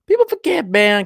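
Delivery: very high, emphatic voice; high-pitched emphasis. Real, not acted. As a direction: repeat it way up high. Repeats own remark for emphasis.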